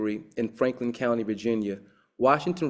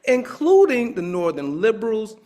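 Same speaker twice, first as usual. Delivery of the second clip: excited lecturing; 10 semitones higher, 7 dB louder than usual. Real, not acted. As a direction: build it up like a revelation, laughing once. Lecturer climaxes about the Wizard.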